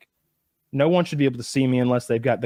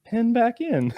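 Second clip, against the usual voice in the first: quoting himself; 10 semitones higher, slower than usual. Retold or imitated. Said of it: "I was like".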